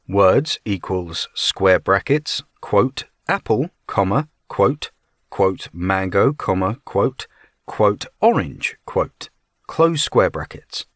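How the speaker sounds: slow, deliberate dictation; dictating. Shown as a demonstration. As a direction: spell out each symbol slowly. Reading code syntax aloud.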